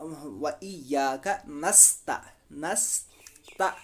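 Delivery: very slow, syllable by syllable "व इया का नस्ता"; slow Quranic reading; slow recitation. Shown as a demonstration. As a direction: stretch every syllable. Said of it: "जज़्म वाले हरूफ को हम पिछले".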